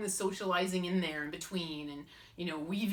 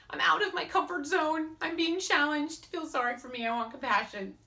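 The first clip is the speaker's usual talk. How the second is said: whiny kitten voice; 13 semitones higher, 6 dB louder than usual. Acted. Mocking needy self with meows.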